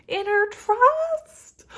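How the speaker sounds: very high, very loud, very slow ecstatic cry; real thrilled outburst; excited shout. Real, not acted. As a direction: shout it out in joy. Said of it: "I'm excited for you".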